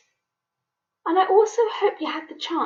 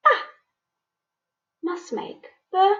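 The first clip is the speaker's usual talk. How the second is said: ordinary talk, nothing marked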